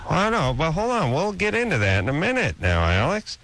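ordinary talk

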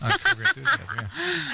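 high-pitched laugh through the words. Real, not acted. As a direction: laugh while you say it. Host laughing at caller's story.

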